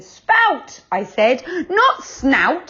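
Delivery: high, loud, sharp correction; cross. A narrator's reading. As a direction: snap back a correction. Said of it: "said Joe crossly".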